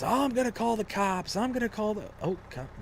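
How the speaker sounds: high, whiny mimicking voice; mocking the neighbor. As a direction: parody the neighbor's threat sarcastically. Mocking neighbor threatening cops.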